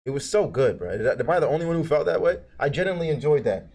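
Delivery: ordinary delivery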